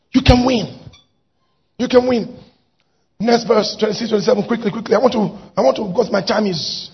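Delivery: ordinary delivery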